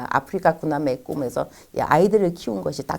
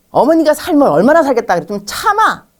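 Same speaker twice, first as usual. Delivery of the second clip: high, raised irritated retort; exasperated husband; 7 semitones higher, 6 dB louder than usual. Acted. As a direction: act out a husband losing patience with his wife.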